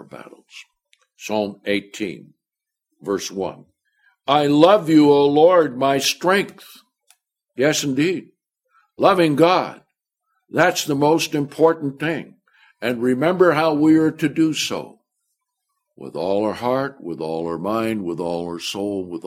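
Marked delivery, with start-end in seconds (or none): 4.3-15.0 loud, high preaching; preaching at full force. Acted. Preacher booming a psalm to the congregation, loud and high. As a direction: lift the verse up to the congregation.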